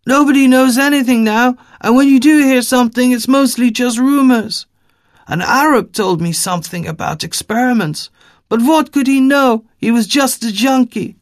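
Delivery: put-on high, loud, dismissive Eastern European accent; Eastern European woman. Fed-up woman dismissing the rumors, Eastern European accent. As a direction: rattle off the rumors, scornful. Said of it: "thick Eastern European accent in her voice".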